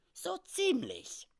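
very high, drawn-out squeaky voice; odd little man, unhurried and squeaky; little comic frontiersman. Acted. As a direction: answer slowly in a comic falsetto. Sam Hawkins, the little man, answers.

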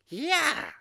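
put-on very high, loud old trapper; short squeaky yes. Sam's short answer in character.

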